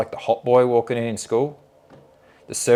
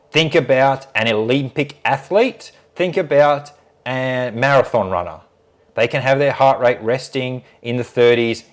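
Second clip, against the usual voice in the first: loud, emphatic imitated telling-off; very stern; 3 semitones higher, 5 dB louder than usual. Surgeon sets the nurse straight about heart rate, stern. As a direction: lecture her firmly, driving each point home. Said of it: "very stern with her at this point".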